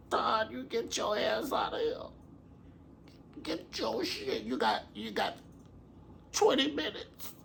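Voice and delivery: high, thin mock-mother voice; Mama Joy. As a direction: order him out in a squeezed high voice. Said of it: "Mama Joy's gonna be like".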